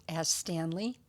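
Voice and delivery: ordinary delivery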